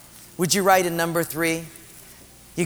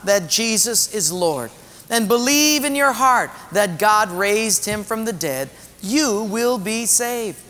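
deliberate, slightly raised recitation; scripture read aloud; 4 semitones higher than usual. Acted. Pastor leading the next scripture on salvation, deliberate reading aloud. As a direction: stretch the phrases so everyone reads along.

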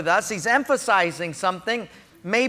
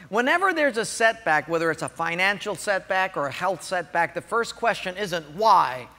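normal talking voice

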